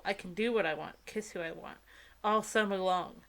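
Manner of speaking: usual speaking voice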